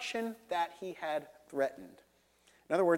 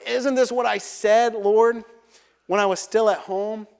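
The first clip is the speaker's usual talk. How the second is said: exasperated complaint; 4 semitones higher, 6 dB louder than usual. Acted. Jonah's complaint quoted in prayer.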